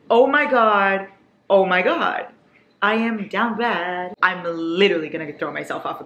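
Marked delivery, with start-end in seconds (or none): none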